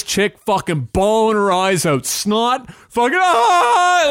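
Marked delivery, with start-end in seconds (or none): 3.2-4.1 high, slow, louder stretched wail; a retold shriek played up for laughs; mock scream. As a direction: imitate her shrieking, drawn out. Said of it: "like, screaming".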